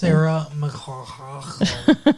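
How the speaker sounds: "McLachlan" dragged out with throaty hacking; very slow "McLachlan", the "ch" comically gargled; exaggerated throaty name. Host mocks the name co-host just said, overdone throaty "McLachlan".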